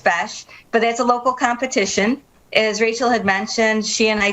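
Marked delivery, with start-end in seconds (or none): 0.0-0.5 "fesh" with a short E and SH ending; correct Irish pronunciation. Shown as a demonstration. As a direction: say "fesh" to rhyme with "mesh". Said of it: "It's pronounced fesh".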